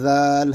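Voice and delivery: ذال opening on a light voiced th, vowel stretched long; tongue past the teeth, draw out ذال; light lisped ذال. Shown as a demonstration. Teacher demonstrating the letter, ذال lightly buzzed and stretched. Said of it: "ہلکی سی آواز نکالیں گے".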